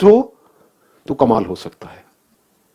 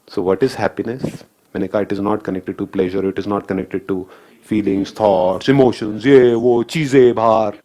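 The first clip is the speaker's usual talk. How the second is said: slow and grave; 8 semitones lower, much slower than usual. Real, not acted. Slow weighty key point.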